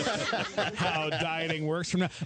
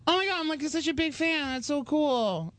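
high mock-excited girl voice; gushing girl fan; 9 semitones higher than usual. Girl tells him she's a big fan, imitated gushing. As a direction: imitate a thrilled fan, stretching the end. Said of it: "She goes".

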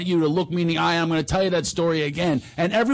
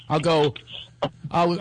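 ordinary talk

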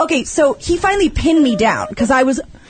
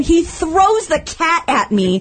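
usual speaking voice

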